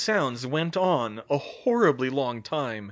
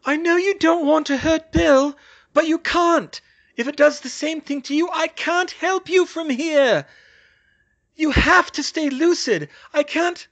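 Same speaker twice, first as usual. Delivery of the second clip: high, loud, desperate plea; frantic high-pitched appeal; tearful pleading; 9 dB louder than usual. A narrator's reading. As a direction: beg him from afar, pitched way up. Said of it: "tears streaming down her face".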